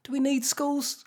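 imagined voter musing. Retold or imitated. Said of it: "a lot of people will be thinking this, going".

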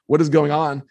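plain reading voice

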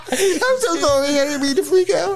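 high, laughing mock sob; high hammed-up tearful hobbit; mock tearful Bilbo. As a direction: blubber an apology up high.

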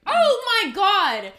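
loud, high, slow exclamation; sudden realization. Real, not acted. Podcaster remembering her answer, loudly excited. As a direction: burst out as the idea lands. Sudden idea while thinking.

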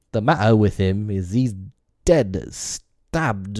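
hollow-voiced report with an accent. A narrator's reading. Shaken pirate telling his captain of a killing, hollow. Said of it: "replied Cecco in a hollow voice".

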